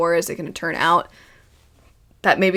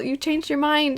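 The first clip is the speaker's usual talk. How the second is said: put-on sweet childlike Zuko; 7 semitones higher, 5 dB quieter than usual. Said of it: "Zuko genuinely seemed sweet happy".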